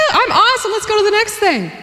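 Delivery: high, breezy swagger; cocky breezy. Retold or imitated. Wife after recovering, high cocky breeze. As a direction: toss it off, all is well. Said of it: "You're like".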